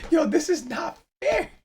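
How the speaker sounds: high, slow wail of protest; mock outrage. Real, not acted. Host mock-protesting his rival's win, high and wailing. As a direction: cry out that it's unfair.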